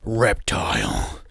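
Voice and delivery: low, slow, drawn-out announcer boom; announcer name call. Retold or imitated. Game announcer calls out Reptile, low and slow. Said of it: "you just hear a name".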